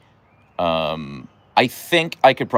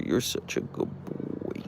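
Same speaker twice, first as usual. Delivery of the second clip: slow whispered praise to the dog; 8 semitones lower, 7 dB quieter, slower than usual. Real, not acted. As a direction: breathe it softly to him. Soothing her dog.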